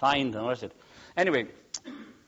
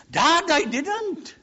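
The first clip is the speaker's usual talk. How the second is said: put-on high, slow, loud child's protest; little boy without Welsh accent; 10 dB louder, much slower than usual. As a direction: protest to Dad like a small lad. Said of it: "He didn't say it with a Welsh accent".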